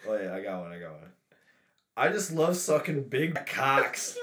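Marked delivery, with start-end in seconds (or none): 2.0-4.2 mocking impression.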